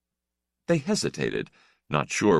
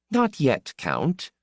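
midwife, much higher, brushing him off; curt midwife; 10 semitones higher, slower than usual. Acted. Midwife to the Count, waving him off, high and curt. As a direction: dismiss him like a busy midwife. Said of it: "the midwife said with contempt".

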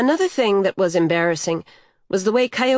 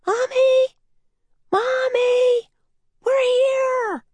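put-on very high, tiny children's voices calling; faint muffled chorus; slower than usual. A narrator's reading. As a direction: squeal it out like far-off little ones. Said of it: "muffled chorus came from over".

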